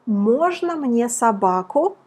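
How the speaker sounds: normal talking voice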